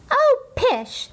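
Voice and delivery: very high airy oh pish; dismissive mother. Acted. Mother waving away her daughter's worry, high and airy. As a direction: brush the worry away lightly.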